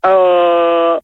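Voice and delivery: deep ah sound; low ah. Shown as a demonstration. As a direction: drop the ah down low. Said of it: "un A plus grave". (voix grave)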